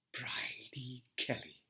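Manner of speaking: a hushed, breathy whisper of the name; faint whisper, barely voiced. A narrator's reading. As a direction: breathe the name like a secret memory. Name recalled in memory.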